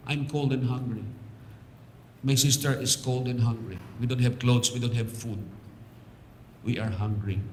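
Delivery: soft, understated voicing of a hungry child; subdued, sorrowful retelling of a child's plea; retold crying child. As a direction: quote a tearful child gently, without sobbing. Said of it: "umiyak siya".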